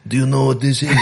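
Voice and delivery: a hammed-up Mexican accent on a movie villain line; bad supervillain accent. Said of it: "That's a terrible accent".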